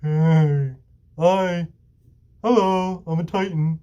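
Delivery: a dopey, cheerful giant saying hi; mock-innocent monster greeting; goofy friendly Titan. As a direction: make the monster sound harmless and silly. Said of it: "the Titans are like".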